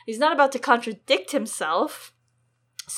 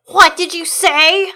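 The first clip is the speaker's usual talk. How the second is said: angry king; 7 semitones higher, 14 dB louder, slower than usual. Acted. Joffrey confronts Sansa.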